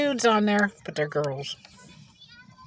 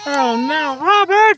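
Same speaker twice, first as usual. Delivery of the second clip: much higher, loud alarmed call; 7 semitones higher, 8 dB louder, slower than usual. Real, not acted. Mother calling out to crying baby Robert.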